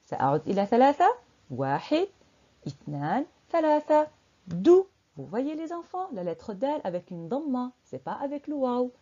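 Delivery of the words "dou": "dou" brief and loud, not held; short vowel. Shown as a demonstration. Teacher revealing the answer to children, "dou" kept short. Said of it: "Parce que معلمة قالت دو".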